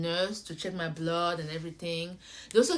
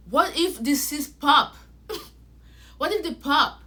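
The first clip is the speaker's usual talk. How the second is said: worried panic; 6 semitones higher, 7 dB louder than usual. Retold or imitated. Panicked about cysts popping.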